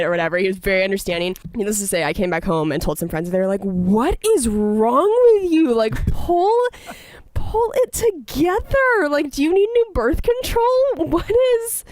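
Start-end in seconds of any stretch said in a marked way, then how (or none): 3.6-11.8 high-pitched, drawn-out mock scolding; exasperated scolding. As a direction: act out friends fed up with you. Friends scold her in retold quote.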